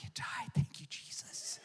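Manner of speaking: mock relief breaking into laughter; whispered laugh. Pastor joking the dog died, laughing in a whisper. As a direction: whisper it guiltily through a laugh. Dark joke about dog.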